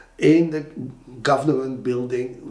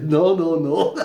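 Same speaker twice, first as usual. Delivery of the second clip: laughing; 3 semitones higher, 4 dB louder, slower than usual. Real, not acted. Laughing refusal.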